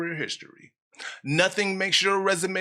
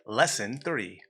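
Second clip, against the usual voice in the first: usual speaking voice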